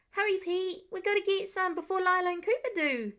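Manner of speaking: high, bouncy kindergartner voice, hurrying his brother; a small kid tugging Pete to breakfast; eager little boy. Acted. Eager kindergartner urging big brother.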